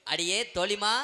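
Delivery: loud call to a friend. Acted. Performer summons her friend on stage, calling out loud. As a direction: call out for your companion. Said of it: "டோலியை கூப்பிடு".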